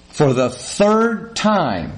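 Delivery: exasperated, drawn-out retort; acted fed-up husband, very slow; incendiary. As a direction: stretch each word in mock exasperation. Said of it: "the incendiary thing starts".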